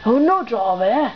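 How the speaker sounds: ordinary delivery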